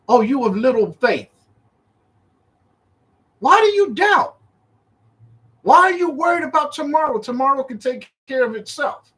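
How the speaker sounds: high-pitched, exasperated acted rebuke; indignant questions, pitched well up; scolding master. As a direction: scold them like someone woken from sleep. Acts woken Yahshua scolding his disciples.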